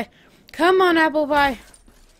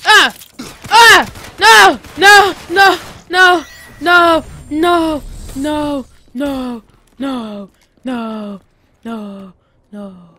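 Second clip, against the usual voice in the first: despairing shout; 9 dB louder, much slower than usual. Real, not acted. His character dies in game.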